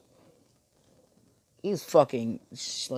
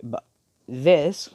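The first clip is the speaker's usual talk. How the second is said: ordinary delivery, nothing marked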